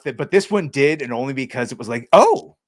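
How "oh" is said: much higher, louder Oh; mock surprise. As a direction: gasp it out in fake shock. Mock shocked reaction.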